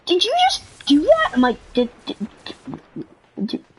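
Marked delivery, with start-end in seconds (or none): 0.0-1.3 scandalised classmate. Retold or imitated. Classmate scolding her for her words, high mock shock. 1.7-3.6 halting mock stammer; lost for words. Student scolded by classmate, speechless stammering. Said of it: "I was, like, lost for words".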